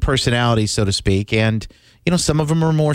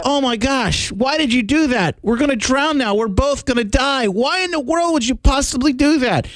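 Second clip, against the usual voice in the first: higher, dismayed frog voice; panicked frog; 7 semitones higher than usual. Retold or imitated. Host voicing the drowning frog, high and alarmed. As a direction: plead like the doomed frog, pitched up. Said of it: "And the frog says".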